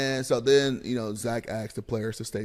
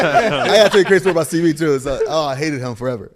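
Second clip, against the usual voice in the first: usual speaking voice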